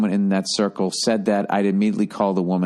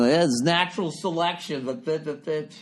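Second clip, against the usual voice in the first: mock careless-parent voice, quieter, trailing away; a sneering put-on parent muttering, softer; 6 semitones higher, 4 dB quieter than usual. Parodying a careless park parent, softer and mocking. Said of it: "And they start doing".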